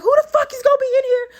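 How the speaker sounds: shrieking mock outrage, very high; hammed-up squealing protest, near laughter; going off. Patient cussing out her therapist, outraged. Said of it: "I went off in that bitch".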